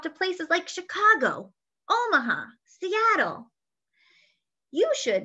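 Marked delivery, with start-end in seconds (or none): none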